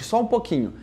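ordinary talk

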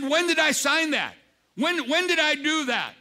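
high, incredulous protest; as if he can't believe it; king learning he signed the decree; shocked disbelief. Retold or imitated.

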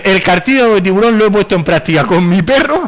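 high, loud, laughing read-out; laughing mock quote. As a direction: read the absurd comment out, cracking up. Reads absurd comment out, laughing. (lectura burlona, aguda y entre risas)

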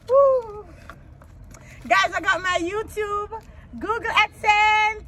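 real elated bragging; jubilant. Real, not acted. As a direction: celebrate like you've finally won. Celebrating the approval.

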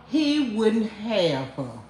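drawn out very slowly, each word weighted; slow deliberate repetition; slow emphatic repeat. Shown as a demonstration. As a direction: spell it out slowly for them. Repeating the point, slowed.

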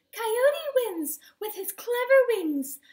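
high, loud, slow proclamation; announcing a winner. A narrator's reading. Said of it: "Lulu finally announced".

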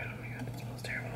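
hushed, fast whisper of disgust. Real, not acted. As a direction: breathe it out, barely voiced, disgusted. Disgusted aside under breath.

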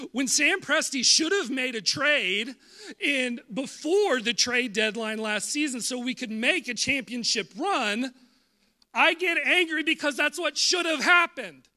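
loud, high, heated sports-fan rant; mock angry rant. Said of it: "Yeah, I'm angry".

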